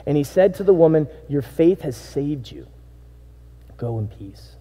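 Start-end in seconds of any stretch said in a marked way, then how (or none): 1.3-4.5 slow, soft and tender; fading down into a hush; gentle blessing. Acted. Jesus telling the forgiven woman to go in peace, softly.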